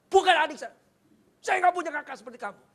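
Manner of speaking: high, loud, fast furious rejection; angry denial. Retold or imitated. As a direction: throw off his brother in rage. Brother rejecting the judge.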